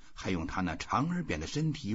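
ordinary delivery

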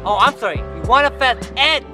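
ordinary talk, nothing marked

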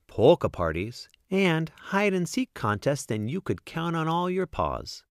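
usual speaking voice

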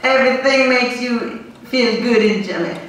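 tentative first go at a Jamaican accent. Shown as a demonstration. Comic trying out the key phrase, Jamaican accent. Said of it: "secret to speak a Jamaican accent".

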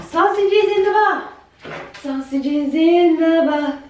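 high playful sing-song. Real, not acted. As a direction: chant it to the dogs.

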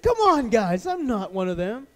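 high, loud plea of denial; pleading protest. Acted. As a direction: protest your innocence to the crowd. Acted Peter protesting denial.

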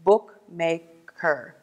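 "Bookmaker" slowly split into book, ma, ker; syllable by syllable. Shown as a demonstration. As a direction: say "Bookmaker" in three slow, separate beats. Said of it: "Three syllables".

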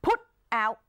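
"put", a break, then "out" as two separate words; put and out unlinked. Shown as a demonstration. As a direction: say "put" and "out" apart, no linking. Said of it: "มันจะกลายเป็นสองคำ". (อ่าน "put" กับ "out" แยกเป็นสองคำ)

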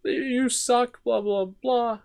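retold screaming. Kid telling off the coach, high mock scream. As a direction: squeal the insult up high. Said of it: "I'm screaming, crying at him".

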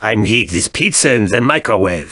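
usual speaking voice

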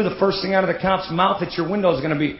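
ordinary talk, nothing marked

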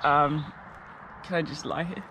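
sleepy, just-woken groggy mumble; drowsy and groggy, barely awake. Real, not acted. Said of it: "I probably sound groggy 'cause I just woke up".

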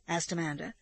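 plain reading voice

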